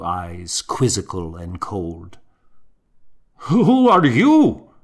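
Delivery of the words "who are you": high, loud, slow startled demand; a monk startled into breaking his vow of silence; startled question. A narrator's reading. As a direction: blurt it out in shock. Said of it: "he asked, startled enough to speak".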